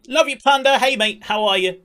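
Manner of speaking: loud, high burst of affection; excited greeting. Real, not acted. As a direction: call out brightly to a friend. Calls greeting to arriving friend.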